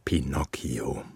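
whispered name. Acted. Father whispering his son's name at bedtime.

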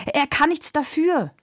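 high, pleading defence; urgent protest. A narrator's reading. Carlos defends Leo to the coachman, protesting. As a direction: plead for the horse. Boy defends the beaten horse.

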